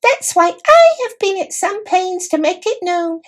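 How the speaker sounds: high squeaky voice of a dignified little mouse; a tiny knight, formal and put out; proud mouse knight. A narrator's reading.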